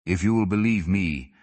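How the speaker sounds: plain reading voice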